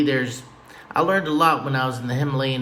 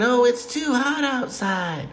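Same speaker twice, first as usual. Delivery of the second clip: higher, whiny complaint; whiny relative; 9 semitones higher than usual. Retold or imitated. Family member griping about the hot weather, higher whine. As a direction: moan about the heat. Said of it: "I'm like".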